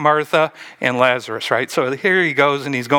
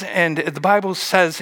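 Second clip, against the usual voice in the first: usual speaking voice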